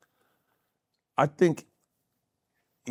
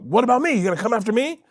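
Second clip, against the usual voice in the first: eager challenge; 11 semitones higher, faster than usual. Retold or imitated. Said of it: "[name]'s about".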